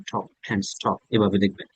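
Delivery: normal talking voice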